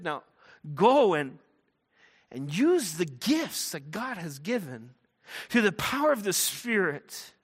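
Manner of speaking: high, loud charge to go; rousing commission. Acted. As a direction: send them out with force. Apostles commissioning the chosen men, acted.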